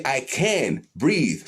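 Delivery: very slow, stretched-out chant; slow affirmation. Shown as a demonstration. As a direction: let each word ride a long breath.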